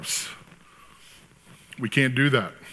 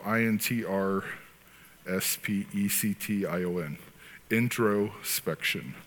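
slow, lower and softer, then the whole word drawn out; slow spell-out; 4 semitones lower, 6 dB quieter, slower than usual. Shown as a demonstration. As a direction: spell it slowly, letter by letter.

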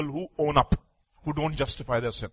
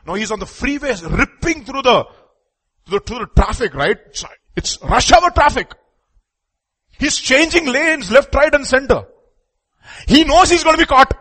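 raised, urgent storytelling from the pulpit; high, loud sermon story; animated preaching; 10 semitones higher, 10 dB louder than usual. Real, not acted. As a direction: build the chase higher and louder.

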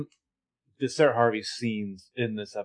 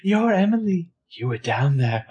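half-hearted Sarah voice; 7 semitones higher than usual. Retold or imitated. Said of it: "I can't do it all".

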